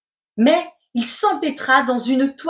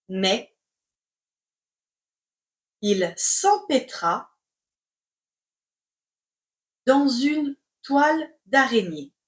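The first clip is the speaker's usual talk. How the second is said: slow, chunked with long pauses; slow dictation. Shown as a demonstration. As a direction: dictate word by word. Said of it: "C'est parti pour la dictée".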